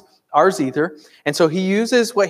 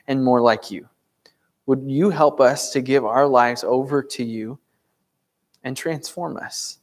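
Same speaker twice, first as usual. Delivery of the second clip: earnest prayer; 4 semitones lower than usual. Acted. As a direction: pray over the congregation, sincerely and gently. Pastor leads congregation in prayer.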